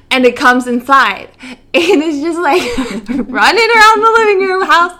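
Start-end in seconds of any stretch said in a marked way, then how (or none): none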